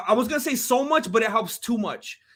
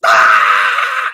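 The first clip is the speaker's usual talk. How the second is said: high loud yell; fighter's scream; imitating a yell; 12 dB louder than usual. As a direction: belt it out like a fighter. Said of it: "Adesanya went".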